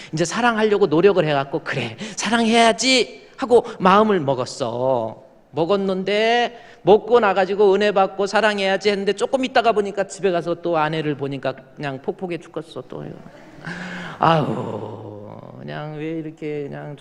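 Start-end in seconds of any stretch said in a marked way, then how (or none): 1.6-3.3 mock resolve. Resolving to love, retold. 14.2-15.6 mock groan. Exasperated at the wife.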